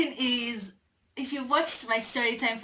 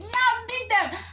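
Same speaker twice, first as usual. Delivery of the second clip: eager; 11 semitones higher than usual. Retold or imitated. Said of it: "I'm like".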